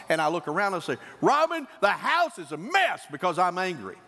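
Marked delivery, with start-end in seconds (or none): 1.2-4.0 high-pitched, irritable acted scolding; taking anger out. Husband home upset, scolding his wife, angry. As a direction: come home and pick a fight, voice climbing. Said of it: "taking my anger out on her".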